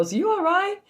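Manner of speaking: lightly voiced husband checking in; a hint of the concerned husband; husband asking. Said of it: "He called me".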